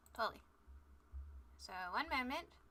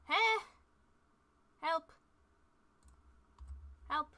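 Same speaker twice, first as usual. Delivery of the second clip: mock cry for help; 4 semitones higher, 3 dB louder, slower than usual. Comic panic searching for link.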